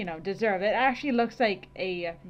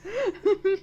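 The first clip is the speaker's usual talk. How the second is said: slow, loud laughing cry; 6 semitones higher, 4 dB louder, slower than usual. Real, not acted. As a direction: gasp it out while laughing. Laughing hard.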